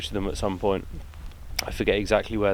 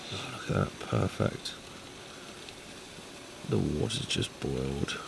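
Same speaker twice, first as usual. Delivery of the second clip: whispered aside; 6 semitones lower, 10 dB quieter than usual. Real, not acted. Quiet aside over the stove.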